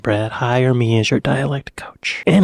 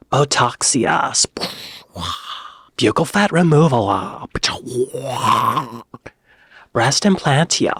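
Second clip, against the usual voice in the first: put-on valley girl accent. Valley girl wizard casting cosmetic spells, valley girl accent. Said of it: "Harry Potter goes to the valley".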